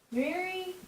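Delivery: ordinary delivery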